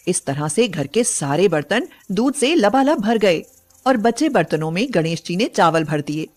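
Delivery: usual speaking voice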